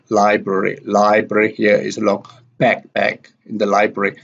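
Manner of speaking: ordinary delivery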